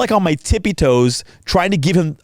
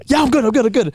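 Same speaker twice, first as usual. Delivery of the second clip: high, hurried mock reassurance; imitated breathless reassurance; 8 semitones higher, faster than usual. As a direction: play the recovering friend waving off concern. Voices friend recovering, insisting he's fine.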